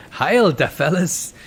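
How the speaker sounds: put-on high, loud, drawn-out German-accented salute; loose German accent. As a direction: hail them in a rough comic German accent. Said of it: "I'm doing a very loose German accent".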